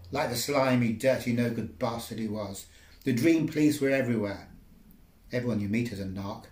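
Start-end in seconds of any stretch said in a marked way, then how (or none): none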